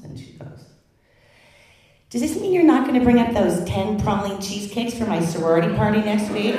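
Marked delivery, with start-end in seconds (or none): 2.1-6.5 higher, louder whiny college daughter. Retold or imitated. Daughter on the phone asking for cheesecakes, whiny. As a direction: whine it like a spoiled student.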